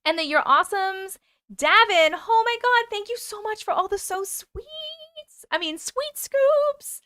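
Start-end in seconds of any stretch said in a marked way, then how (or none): 1.5-7.0 high, drawn-out delighted squeal; excited squeal. Real, not acted. Squealing over a viewer's gift.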